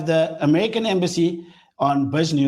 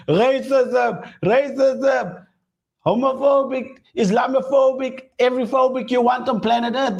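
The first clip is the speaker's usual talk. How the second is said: hammed-up protest cries, pitched high; mock screaming; 7 semitones higher than usual. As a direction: imitate an outraged crowd crying out accusations. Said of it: "Everybody's screaming on the left".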